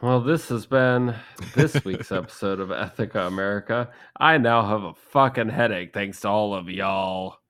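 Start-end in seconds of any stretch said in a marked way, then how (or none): 0.0-3.9 announcer voice. Acted. Show sign-off.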